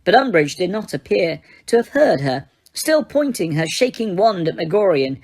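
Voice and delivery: ordinary delivery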